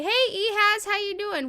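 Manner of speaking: high, bright Southern hello; Southern greeting. Pretend. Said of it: "what is this, Southern?".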